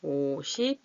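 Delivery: stretched syllables with pauses between. Real, not acted. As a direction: say each part as you write it. Writing the number while saying it.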